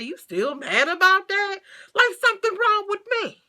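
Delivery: mock how he sounded. Quoting ex's reply to her.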